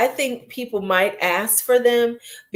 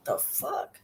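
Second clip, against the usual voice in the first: whispered disbelief; 5 semitones higher, 16 dB quieter than usual. Retold or imitated. Reacting to pretentious friend.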